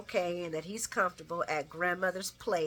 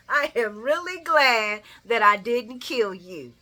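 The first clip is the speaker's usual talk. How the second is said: louder, slow savoring repeat; 8 dB louder than usual. Retold or imitated. Vlogger savoring Ava's line again. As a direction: relish every word. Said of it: "I love me some Ava".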